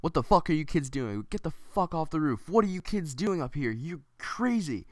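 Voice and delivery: high, loud outraged yelling; angry cursing man. Retold or imitated. Fat guy ordering kids off the roof, furious high shouting. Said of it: "he starts cursing".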